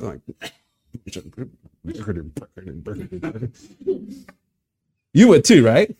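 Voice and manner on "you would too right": ordinary talk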